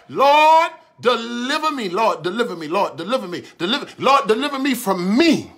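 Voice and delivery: rising fervent cry of Lord deliver me; prayed plea. Shown as a demonstration. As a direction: plead it like a prayer at the altar. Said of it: "this has to be your prayer".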